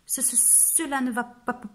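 teeth chattering. Acted. Frozen wolf shivering at the mirror, teeth chattering. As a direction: stammer through chattering teeth. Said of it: "En claquant des dents".

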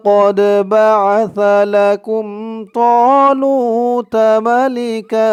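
slow, melodic recitation of the verse; Quran recitation. Shown as a demonstration. Teacher reciting the verse for students to repeat. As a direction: chant it slowly and clearly. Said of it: "আয়াতের শুরু থেকে আমার সাথে বলুন".

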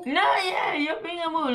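insist, almost shouting; excited protest. Real, not acted. Laughing argument.

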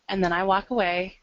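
ordinary talk, nothing marked

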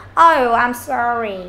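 slow, drooping apology; slightly sad. Shown as a demonstration. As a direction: say sorry with the voice sagging a little. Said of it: "giọng hơi buồn".

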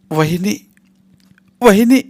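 high, loud, shaking cry of a name; trembling voice. Acted. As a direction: struggle to get the word out. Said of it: "तिचा आवाज थरथरत होता".

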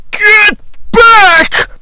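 very high, loud yell; bus driver yelling. Retold or imitated. Bus driver to kids after second explosion, yelled. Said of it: "again the bus driver yells".

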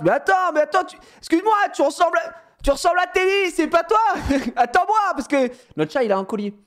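high, urgent coaxing; high, breathless pleading to a cat on the terrace. Retold or imitated. Chasing cat, calling out.

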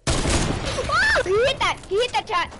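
loud, high, amazed yelling; excited shout. Real, not acted. Excited reaction to a shot.

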